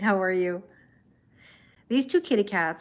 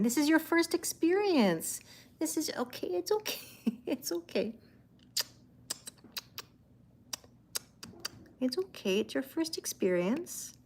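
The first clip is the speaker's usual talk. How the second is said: soothing pet talk; 8 semitones higher, 4 dB quieter than usual. Real, not acted. Soothing the scared cat on camera.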